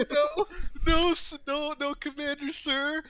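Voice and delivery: mock nervous recruit. Recruit answering a glaring drill instructor, nervous and high. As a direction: squeak out the denials like a scared recruit. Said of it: "laser beam eyeing you".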